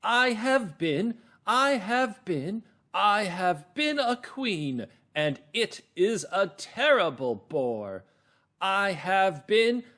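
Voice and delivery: high, loud, drawn-out theatrical queen; queen's big part. Acted. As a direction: declaim slowly as a grand, complaining queen. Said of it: "here's that big part again. The queen".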